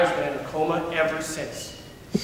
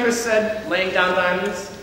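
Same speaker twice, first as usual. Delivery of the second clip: plain reading voice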